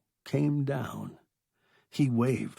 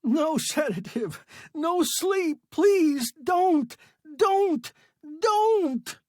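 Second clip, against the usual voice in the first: loud, ever higher pleading scream; 6 dB louder, slower than usual. A narrator's reading. Stranded man begging rescuers, rising screamed plea. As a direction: climb higher on each don't, begging. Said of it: "screamed Sale".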